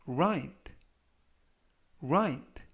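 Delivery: "right" said as rait, with silent gh and a light final t; model pronunciation. Shown as a demonstration. Teacher pointing out the silent gh for learners, careful pronunciation. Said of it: "라고 발음하시면 되겠고요".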